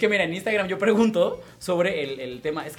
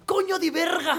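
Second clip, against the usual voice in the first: high, put-on British accent swearing in Spanish; British cursing; 11 semitones higher, 4 dB louder, slower than usual. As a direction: curse like a Brit mangling Spanish. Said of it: "siempre que la cagaba en algo decía".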